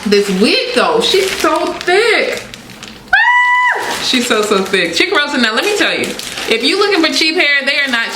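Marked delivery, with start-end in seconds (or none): 3.1-4.0 sharp very high squeal; delighted squeal. Real, not acted. Squealing at how thick the wig is. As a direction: shriek with delight.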